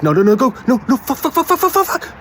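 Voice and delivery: acted panic, pitch climbing steeply; hammed-up terror on a bus; freaking out. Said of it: "I'm freaking out".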